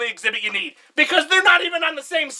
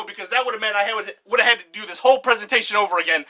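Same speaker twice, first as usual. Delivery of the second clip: ordinary delivery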